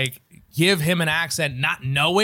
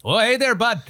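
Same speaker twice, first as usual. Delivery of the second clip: put-on higher, louder folksy Maritime accent; 7 semitones higher, 4 dB louder than usual. Comic imagining his dad's voice, in a mock Maritime accent. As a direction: greet a pal in a broad Maritime accent. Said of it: "a maritime accent".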